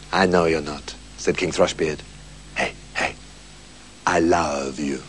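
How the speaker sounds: low, soft, gentle comfort; low soft loving voice; tender. Acted. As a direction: soothe her softly. King comforts softly.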